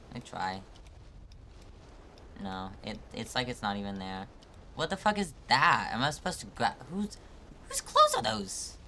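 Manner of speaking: usual speaking voice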